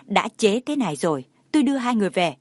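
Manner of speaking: ordinary delivery, nothing marked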